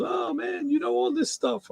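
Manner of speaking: imitating the mailman's Jamaican admiration; Jamaican accent. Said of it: "So he got this Jamaican accent".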